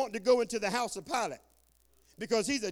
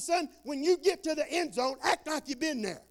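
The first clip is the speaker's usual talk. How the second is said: coach shouting; 6 semitones higher than usual. Retold or imitated. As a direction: bark it like a coach on the sideline. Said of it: "And I tell him, I said".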